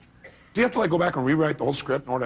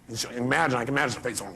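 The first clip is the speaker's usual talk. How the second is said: ordinary delivery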